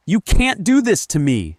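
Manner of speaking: high, loud, desperate scream. A narrator's reading. Linda confronts her cheating husband, screaming. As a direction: scream it in anguish and rage. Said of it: "she screamed".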